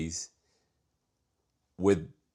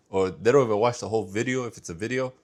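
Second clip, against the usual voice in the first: ordinary talk, nothing marked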